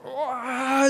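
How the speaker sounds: high, whining cry of protest; mock wail. Believer reacting to God's word, mock crying. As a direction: wail like a baby refusing to hear.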